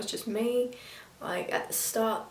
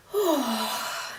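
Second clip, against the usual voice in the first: long falling sigh of relief; relieved sigh; 2 semitones higher than usual. Real, not acted. Reviewer getting her biggest worry off her chest, relieved sigh. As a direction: sigh out a weight off your chest. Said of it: "Now that that's off my chest".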